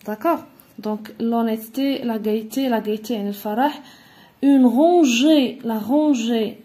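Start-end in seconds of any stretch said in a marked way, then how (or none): 4.4-6.6 loud, very drawn-out syllables; slow dictation. Shown as a demonstration. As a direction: stretch each syllable loudly, like a teacher dictating. Teacher dictates the example word slowly. (dictée très lente, syllabes appuyées)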